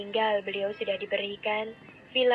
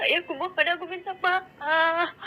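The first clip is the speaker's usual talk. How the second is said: higher, louder desperate tearful begging; sobbing plea; 9 semitones higher, 3 dB louder than usual. A narrator's reading. Daughter begs sister and mother not to abandon her, sobbing. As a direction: beg them not to leave you, crying. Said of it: "terisak pilu".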